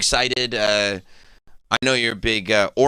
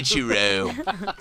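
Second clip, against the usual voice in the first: slow drawn-out "Ichiro" as "Chu-ro"; mock Orlando accent; much slower than usual. Shown as a demonstration. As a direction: stretch "Ichiro" slowly in a put-on Orlando accent. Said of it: "Orlando accent pronounce it".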